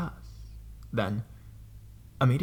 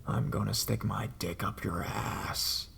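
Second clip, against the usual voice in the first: slow, softer, rasping leer; horrible creepy voice; 2 semitones lower, 7 dB quieter, slower than usual. Retold or imitated. Creepy caller taunting the man selling an Xbox, slow soft leer. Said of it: "In the most horrible voice".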